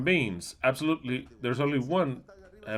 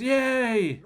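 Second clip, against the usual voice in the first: high mock cheer; a quick sarcastic squeal of joy; 10 semitones higher than usual. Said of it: "in heaven somebody says".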